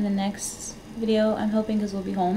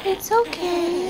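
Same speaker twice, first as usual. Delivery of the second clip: soothing; 9 semitones higher than usual. Real, not acted. Soothing fussing newborn.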